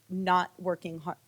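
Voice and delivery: usual speaking voice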